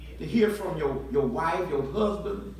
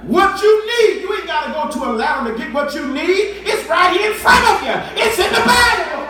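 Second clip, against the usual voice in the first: much higher and louder, laughing through it; laughing preacher shout; 9 semitones higher, 11 dB louder than usual. Real, not acted. Preacher laughing and shouting to the congregation. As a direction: shout it with a grin.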